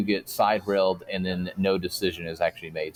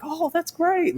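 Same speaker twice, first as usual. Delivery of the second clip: much higher, chirpy sing-song "Oh, that's great."; mock cheerful reply. Replying to someone grieving a lost pet, much higher mock cheer. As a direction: play it falsely bright and upbeat, all wrong for the news. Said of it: "that's a terrible way to say it".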